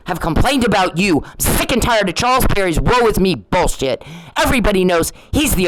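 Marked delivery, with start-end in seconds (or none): none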